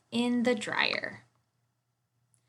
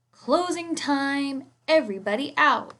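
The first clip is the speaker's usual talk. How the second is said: calling out; 5 semitones higher, 4 dB louder than usual. Acted. Manager calling out.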